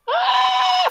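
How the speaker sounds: high shrill anime shriek; anime scream. Acted. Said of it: "classic anime scream".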